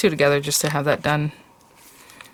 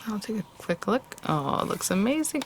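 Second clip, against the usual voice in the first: quiet whisper to herself; 4 semitones higher, 9 dB quieter than usual. Real, not acted. Peeking at the embossed result.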